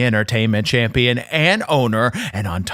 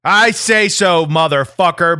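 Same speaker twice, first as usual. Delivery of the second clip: higher, louder slow promo yell; promo shout; 5 semitones higher, 5 dB louder than usual. Acted. Champion yelling a promo at his cohost, high and loud. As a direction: bark it like a heel wrestler.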